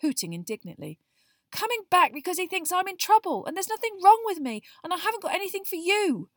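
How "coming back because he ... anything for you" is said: high, loud, angry rant; snapping Harry. A narrator's reading. Boy raging about trouble, then at his owl, snapping. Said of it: "Harry snapped at Hedwig".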